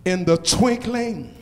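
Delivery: loud rising preacher's call, building; preaching at full force. Acted. Pastor calling out to the congregation, preaching at full force. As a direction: whoop the promises at the crowd.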